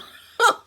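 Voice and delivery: big amused laugh; loud laugh. Real, not acted. Laughing at the story.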